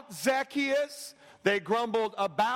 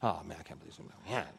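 low, much softer, fast mumbled complaint; grumbling; 10 dB quieter, much faster than usual. Retold or imitated. Crowd grumbling about Jesus, fast and muttered. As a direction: grumble it under your breath.

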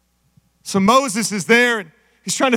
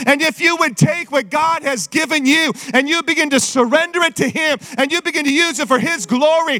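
full-force preaching; 5 semitones higher than usual. Acted. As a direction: preach it hard to the church.

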